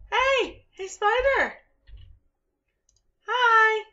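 high, loud call out. Real, not acted. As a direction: yell at the spider. Calling to spider.

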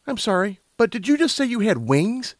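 high-pitched questioning character voice; Lumina, incredulous. A narrator's reading. Lumina questioning the unicorn about wings, incredulous. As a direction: ask it high, in disbelief.

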